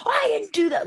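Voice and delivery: very high, protesting imitation; outraged denial. Child wrongly blamed protests to mom, outraged. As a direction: squeal it like a wronged kid. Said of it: "Layla would have lost her mind".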